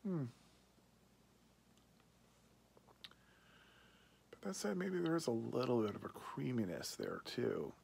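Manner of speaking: speaking around pipe stem; pipe in mouth. Real, not acted. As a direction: lips partly closed on stem. Seen 4: Pipe held at lips throughout, stem likely in mouth; smoke earlier.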